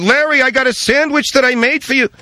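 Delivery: high-pitched, sustained deli-owner character voice. Acted. Deli owner offering Larry a sandwich, high character voice.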